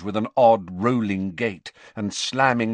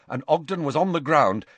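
ordinary delivery, nothing marked

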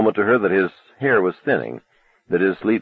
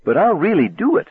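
put-on defiant teen; 12 semitones higher than usual. Arnie defies mother.

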